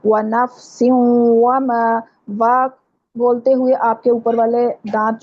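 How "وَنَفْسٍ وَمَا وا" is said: وَنَفْسٍ وَمَا slow, و with rounded lips and no teeth on the lip; rounded waw. Shown as a demonstration. Teacher demonstrating the rounded و sound, slowly. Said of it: "ہونٹوں کو گول کر کے".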